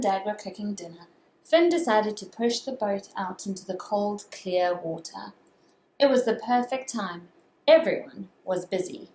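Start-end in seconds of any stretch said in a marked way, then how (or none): none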